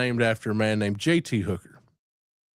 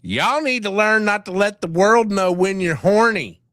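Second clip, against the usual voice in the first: a lightly higher put-on cat-monster voice, folksy; Kaiju Kitty voice; 8 semitones higher, 4 dB louder than usual. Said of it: "practicing my Kaiju Kitty voice".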